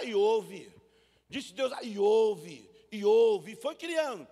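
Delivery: loud, ringing pulpit proclamation; booming preaching. Acted. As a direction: thunder out God's creating word.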